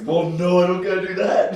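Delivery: high, loud brush-off; cocky dismissive. Retold or imitated. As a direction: wave it off like a big shot. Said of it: "and he's like".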